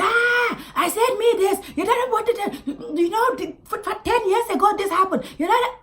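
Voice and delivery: high, raucous mock shouting; loud man mimicked. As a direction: imitate someone yelling obliviously in a café. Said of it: "this man was talking really loud".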